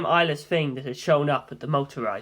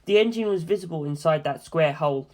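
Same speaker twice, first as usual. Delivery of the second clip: ordinary delivery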